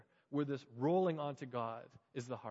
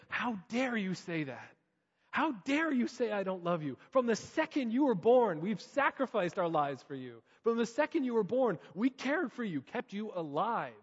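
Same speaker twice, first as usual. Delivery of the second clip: loud, higher, slow outraged parent; exploding parent; 7 semitones higher, 6 dB louder than usual. Retold or imitated. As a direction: let the parent's hurt boil over. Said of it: "not to explode".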